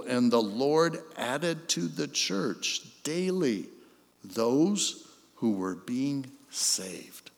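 soft, slow, whispered close; awe-struck hush on the last words; hushed reverence. Real, not acted. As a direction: let it fade to a whisper. Hushed sermon climax.

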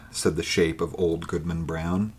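plain reading voice

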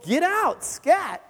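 re-enacted high-pitched shooing; retold aggressive dismissal. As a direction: chase them off, acting out the anger. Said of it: "I got all aggressive".

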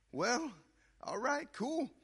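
high, awkward "all right, cool"; awkward mock cheer. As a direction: play it off with a high, forced casualness. Awkward reply retold to relative.

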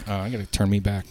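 usual speaking voice

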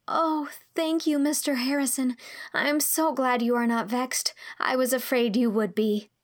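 put-on grateful girlish outpouring; relieved, grateful girl. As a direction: thank him earnestly as a young girl.

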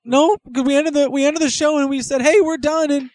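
loud, high-pitched fretting; Morty impression. Morty arguing with Rick about the show, loud and high. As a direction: squeal protests like a panicky kid. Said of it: "when you go that loud".

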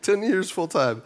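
loud, high, drawn-out fake delight; mock enthusiasm. Preacher reacting to five hundred sermons, mock-enthusiastic.

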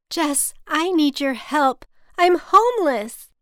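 loud, high, desperate wail; sobbing plea. A narrator's reading. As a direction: beg for help in acted despair. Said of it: "She continued sobbing".

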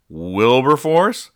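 Wilberforce? dragged out slow and loud, rising; shocked realisation. Retold or imitated. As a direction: stretch out Wilberforce? in dawning alarm. Parents realise they forgot kid.

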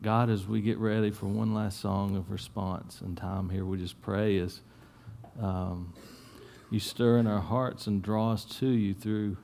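hushed closing prayer. Acted. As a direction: pray gently over the congregation.

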